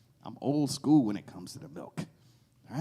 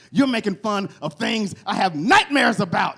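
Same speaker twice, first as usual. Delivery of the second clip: mock angry woman; 8 semitones higher, 9 dB louder than usual. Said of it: "She was like".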